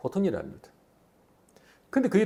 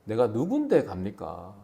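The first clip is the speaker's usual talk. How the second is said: timid Moses; 8 semitones lower, 5 dB quieter, slower than usual. Acted. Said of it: "뭐라고 합니까?".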